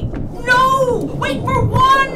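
high, loud yell, slowing on the last words; frantic shout. Acted. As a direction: stop them before it's too early. Countdown to open the van door.

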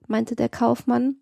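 plain reading voice

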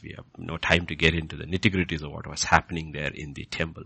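normal talking voice

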